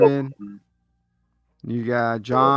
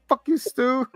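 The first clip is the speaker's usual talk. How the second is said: higher, mocking comeback; high mock retort; 11 semitones higher, slower than usual. Answering his shooting partner, high mocking retort. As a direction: spit it back at him in a silly high voice.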